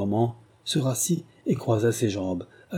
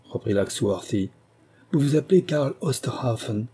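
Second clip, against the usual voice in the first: ordinary delivery, nothing marked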